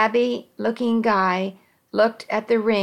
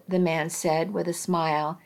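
ordinary delivery

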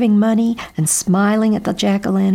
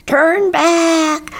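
high, loud squawked warning; squawking crow; 11 semitones higher, 5 dB louder than usual. A narrator's reading. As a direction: squawk the order like an alarmed crow. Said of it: "squawked Bernie Crow".

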